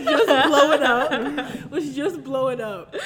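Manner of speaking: loud, laughing storytelling; barely holding in real laughter; laughing hard. Real, not acted. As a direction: crack up while telling it. Laughing at her own story.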